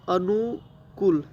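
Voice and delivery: अनुकूल said slowly, syllable by syllable, with a long ऊ in कू; अनुकूल, slow teacher's demo with a stretched ऊ in कू; clear pronunciation. Shown as a demonstration. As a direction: drag out अनुकूल so each sound stands apart. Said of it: "क में हर्ष उ नहीं लगता, दीर्घ उ लगता".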